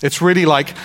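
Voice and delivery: ordinary delivery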